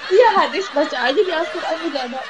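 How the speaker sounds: laughing, high and loud. Real, not acted. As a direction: laugh through the words. Laughing at joke.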